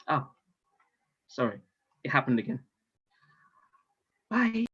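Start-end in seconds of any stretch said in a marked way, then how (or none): none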